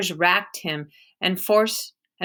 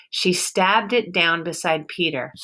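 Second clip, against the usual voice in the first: plain reading voice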